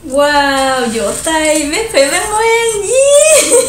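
high, loud cheering shout; excited cheering. Real, not acted. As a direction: cheer wildly, voice breaking. Cheering after eating spicy noodles.

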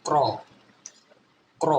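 ক্র with the R joined straight onto the K; R as রফলা. Shown as a demonstration. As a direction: say ক্র as one blended k-r sound. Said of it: "আর এর উচ্চারণ রফলার মতো".

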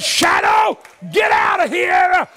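very high preacher's shout, last words stretched out; shouted command. Acted. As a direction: order the shadow out like a triumphant sheriff. God's shouted command to shadow.

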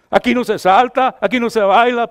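loud, high preacher's rebuke; forceful preaching. Real, not acted. Preacher scolding the congregation, loud and high. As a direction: hammer it home from the pulpit.

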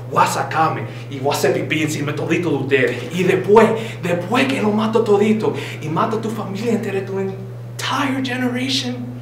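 ordinary delivery

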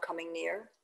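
usual speaking voice

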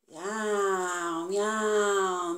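imitated cat meowing. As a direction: meow like a cat. Story cat meows. (miado de gato)

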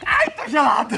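shouting at the freezing water; yelling from the cold; cold shock shout. Real, not acted.

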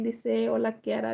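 ordinary talk, nothing marked

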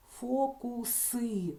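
Фокуси stretched out slowly, with a clear С; clear consonant. Shown as a demonstration. Teacher shows the doubtful consonant, slow clear С in Фокуси. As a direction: draw out Фокуси so the С is heard. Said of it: "цей сумнівний приголосний ми чітко чуємо".

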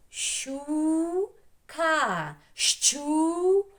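Щука split into syllables, crisp щ, high and loud; clear double sound. Shown as a demonstration. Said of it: "Чітко вимовляємо подвійний звук". («Щу-ка» по складах, з чітким подвійним щ)

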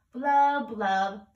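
loud burbling blub blub; washer sound. A narrator's reading. Ant spinning like a washer on the spin cycle. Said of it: "Ant spun around in circles".

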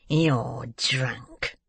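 put-on drawn-out old man's sneer; scornful. A narrator's reading. Old man accusing the girl, scornful. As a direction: sneer it at her, stretching the words.

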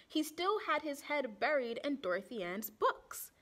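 usual speaking voice

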